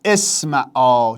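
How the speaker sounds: «اسمعا» stretched out very slowly; slow demonstration. Shown as a demonstration. Teacher revealing the answer slowly to students. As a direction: draw out the vowels for the class. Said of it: "پس اینم ا بده".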